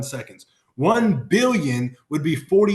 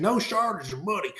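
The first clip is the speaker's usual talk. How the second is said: light imitation of a Southern country accent, softer; a hint of a drawled country accent on "No shortage of money"; 4 semitones higher, 6 dB quieter than usual. A speaker quoting Grant Cardone in a mild country accent. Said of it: "He says in that country accent".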